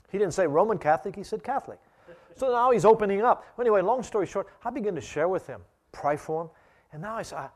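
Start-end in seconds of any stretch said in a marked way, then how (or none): 0.1-5.5 excited. Real, not acted. Excited telling.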